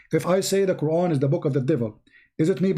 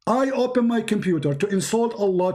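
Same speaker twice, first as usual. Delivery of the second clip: ordinary delivery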